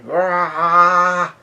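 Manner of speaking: high, drawn-out "rah" yell; half-hearted acted scream, held long; Big Mom's mock scream. Falling off the castle, imitated high scream. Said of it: "Big Mom yells out a scream".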